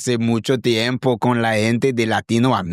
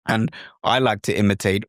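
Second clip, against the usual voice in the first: ordinary talk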